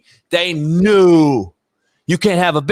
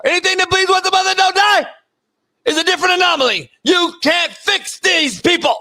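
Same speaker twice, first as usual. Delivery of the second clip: mock preaching rant; 9 semitones higher than usual. Host complaining to the Lord about women, ranting preacher. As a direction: preach it like a fed-up pastor.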